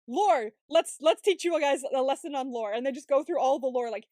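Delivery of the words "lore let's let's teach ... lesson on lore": loud mock lecture announcement; whiteboard lecturer. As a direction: announce it loudly like a pretend teacher. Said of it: "standing in front of a whiteboard going".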